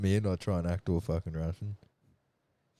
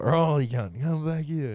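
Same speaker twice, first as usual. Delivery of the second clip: much higher and louder, calling across a room; mock call out; 9 semitones higher, 5 dB louder, slower than usual. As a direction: shout him back, half laughing. Calling mate back, retold.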